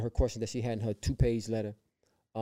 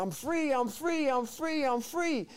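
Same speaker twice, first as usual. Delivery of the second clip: very high, repeated joyful cry; jubilant cry; 13 semitones higher, much slower than usual. Retold or imitated. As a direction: exult in a high-pitched ringing voice. Retold running around rejoicing, freed.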